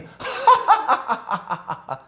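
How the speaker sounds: the world's scornful laugh at the son; mocking laugh. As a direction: laugh at him with contempt. The world mocks God's son. (비웃는 웃음소리)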